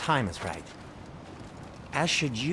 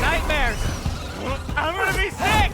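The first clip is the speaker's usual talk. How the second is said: panicked shriek; 7 dB louder than usual. Acted. Sickened dwarf yelling after fight.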